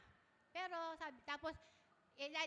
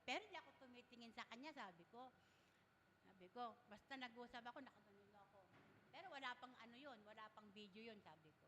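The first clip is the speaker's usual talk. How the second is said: hushed reply; 3 semitones lower, 12 dB quieter than usual. Retold or imitated. Quiet retold reply to son.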